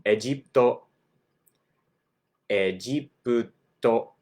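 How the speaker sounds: Egipto as e-ji-pu-to; エジプト; Japanese Ejiputo. Shown as a demonstration. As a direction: Egipto slowed, e-ji-pu-to. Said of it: "The country of Egypt".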